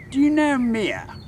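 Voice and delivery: high, loud British question; British old man. Acted. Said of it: "this little British man".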